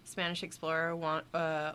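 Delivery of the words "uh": ordinary talk, nothing marked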